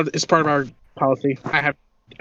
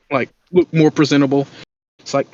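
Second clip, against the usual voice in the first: normal talking voice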